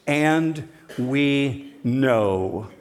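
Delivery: "And we know" drawn out very slowly, each word weighted; emphatic and slow. Shown as a demonstration. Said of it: "lands on something really emphatic".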